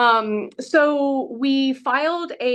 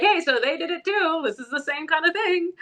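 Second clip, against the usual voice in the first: higher, put-on pleading tone; lightly voiced argument; 8 semitones higher than usual. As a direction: argue the case in a mock voice. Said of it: "to be like".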